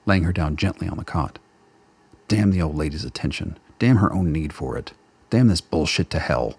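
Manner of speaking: ordinary talk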